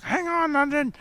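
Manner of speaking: high-pitched mimicked commentator yell; mock a commentator calling out, high and strained. Taz calls out mid-match, calling out.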